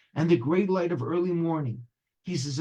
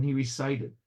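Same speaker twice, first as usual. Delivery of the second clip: plain reading voice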